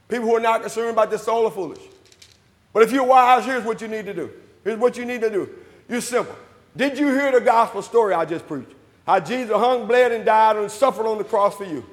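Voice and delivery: ordinary talk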